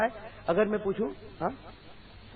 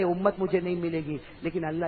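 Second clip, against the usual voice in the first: ordinary talk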